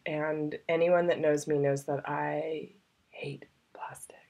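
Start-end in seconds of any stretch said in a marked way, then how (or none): 3.1-4.2 hate plastic, breathed as a hushed aside; a conspiratorial whispered joke. Real, not acted. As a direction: drop to a secret whisper for the confession. Aside about hating plastic.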